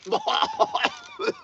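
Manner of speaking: high squealing laugh. Real, not acted. After seeing fish.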